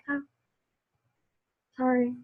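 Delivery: small, soft apology after a pause; retold meek response, trailing to very soft; chastened. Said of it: "it was like a slap".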